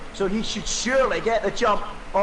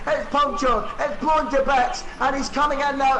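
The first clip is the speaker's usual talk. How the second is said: dramatic commentary; 8 semitones higher than usual. Real, not acted. Commentator reacting to disaster.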